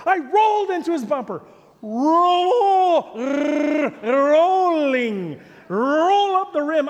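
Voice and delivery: high, loud, drawn-out mock outrage; acted yelling. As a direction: stretch the words into an exasperated re-enacted yell. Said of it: "I'm yelling".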